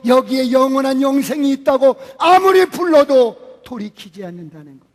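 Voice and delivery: preaching that swells into a loud, urgent call; fervent preaching. Acted. Preaching builds to full force. (점점 고조되는 설교)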